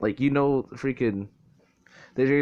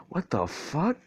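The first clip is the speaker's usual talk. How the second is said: high, sharply rising question; mock stunned disbelief; 7 semitones higher, slower than usual. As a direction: imitate baffled onlookers, pitched up. Men's group's stunned reaction retold.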